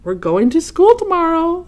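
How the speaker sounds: high, loud eager call; farmer's announcement. Acted. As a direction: announce it to the barn. Farmer announces school to barn.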